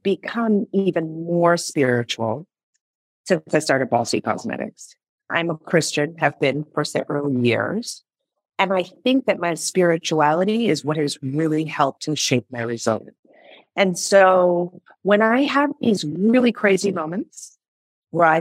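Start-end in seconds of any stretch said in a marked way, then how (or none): none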